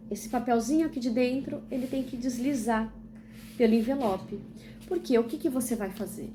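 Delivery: normal talking voice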